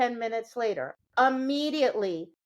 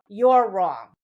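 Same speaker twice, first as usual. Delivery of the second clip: imitating a scold; 2 semitones lower, 7 dB louder than usual. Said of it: "we just say to the dog".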